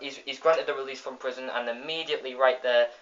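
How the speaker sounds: ordinary talk, nothing marked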